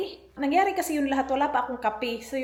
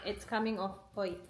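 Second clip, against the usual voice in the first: ordinary talk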